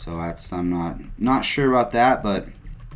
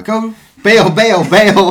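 much higher, louder panicked yell; 12 semitones higher, 12 dB louder, much slower than usual. Pretend. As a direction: yell it like you're about to crash. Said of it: "I'm just gonna go".

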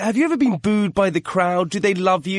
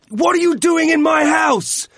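shrill gloating wrestling manager; 12 semitones higher, 3 dB louder than usual. Pretend. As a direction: yell it like a cocky heel manager. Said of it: "What would you say to her?".

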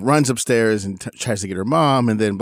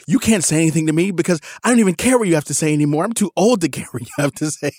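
higher, louder and fast mock teenage rant; imitating furious teen; 8 semitones higher, 4 dB louder, faster than usual. Dad mimicking daughter's nuclear rant in the car. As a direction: rattle it off like an exploding teenager. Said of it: "she goes nuclear".

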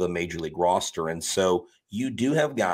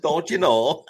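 higher, louder Minnesotan echo; Minnesota accent; 8 semitones higher, 5 dB louder than usual. Acted. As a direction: play back the Minnesotan "Don't you know" brightly. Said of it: "Minnesota accent bit".

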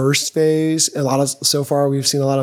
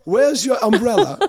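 put-on higher, jokey Mary Poppins British accent; mock British accent; 6 semitones higher than usual. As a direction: play at a British accent with a laugh. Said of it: "It feels very Mary Poppins inspired".

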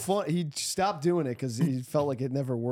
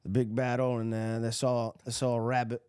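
southern drawl; 5 semitones lower than usual. Retold or imitated. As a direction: imitate a Southern historian telling an anecdote. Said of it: "he had, like, a southern drawl".

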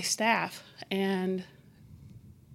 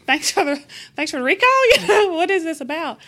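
laughing through it; 8 semitones higher, 12 dB louder than usual. Retold or imitated. Sarcastic reply to teammate's call.

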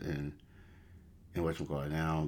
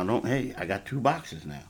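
usual speaking voice

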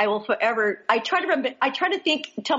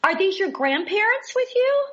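high, bright mock-cheerful greeting, much higher; chirpy greeting; 9 semitones higher than usual. Retelling how she greeted Jim at church, mock-chirpy. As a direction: chirp it out like a friendly surprised hello. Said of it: "And I said".

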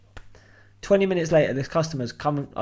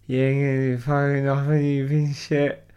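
put-on drunk Clive. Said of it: "he's had too many to drink".

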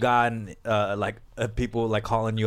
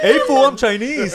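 put-on Latin accent; 12 semitones higher, 7 dB louder than usual. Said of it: "Chinese accents sound Latin".

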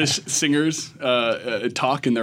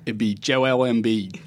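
put-on slow, drawn-out Philadelphia accent; Philly accent; slower than usual. Host shows co-hosts how Philly fans pronounce Embiid, thick Philadelphia accent. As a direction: put on a heavy Philly pronunciation of JOEL EMBIID. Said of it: "How would they pronounce Embiid?".

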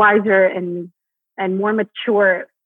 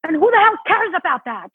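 defiant line; 8 semitones higher than usual. Shown as a demonstration. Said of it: "just deliver".

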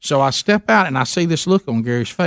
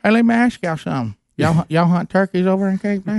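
imitating Gary, pitched higher; Gary's voice; 6 semitones higher than usual. Gary asking the South Africans about turkey hunting, higher imitated voice. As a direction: put on the friend's higher country voice.

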